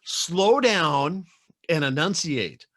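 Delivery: exaggerated exasperation. Telling brother-in-law to speak clearly, mock exasperation. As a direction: spell it out for someone hard to understand.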